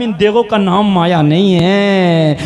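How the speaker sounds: loud, sustained declamation with drawn-out phrase endings; full-force preaching. Real, not acted. Preacher telling the crowd of the old woman's fame, full-force preaching. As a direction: ring it out to the whole crowd.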